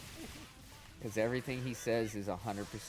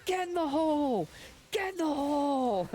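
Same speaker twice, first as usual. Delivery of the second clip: high, loud mock-furious yell; much higher, louder hammed-up rage; Orny Adams yelling; 7 dB louder than usual. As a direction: slam it out like a raging comic. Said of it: "he starts slamming the mic".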